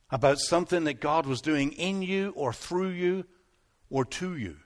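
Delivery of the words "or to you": ordinary delivery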